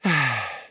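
short, gentle sigh; brief weary sigh; small sigh. A narrator's reading. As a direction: let out a little sigh. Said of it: "gave a small sigh".

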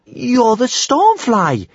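very high, slow, aghast insistence; stammered disbelief. A narrator's reading. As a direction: protest loudly in high-pitched shock. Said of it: "stammered Kamikaze".

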